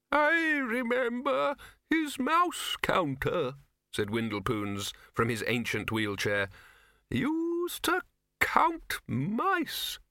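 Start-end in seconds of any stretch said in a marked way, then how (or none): 0.1-3.6 put-on very high, slow, quavering old man; ancient wizard. Old wizard reminiscing from his wheelchair, doddery. 7.1-10.0 put-on very high, slow, quavering old man; ancient wizard. Old wizard reminiscing from his wheelchair, doddery.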